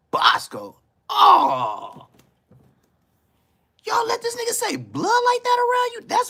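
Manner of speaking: high, loud mock-scandalized cry; mock outrage. Acted outraged reaction to blood.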